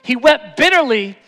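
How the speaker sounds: rising from strong to a high shouted climax; building shout. Acted. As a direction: build it up until the last words ring out.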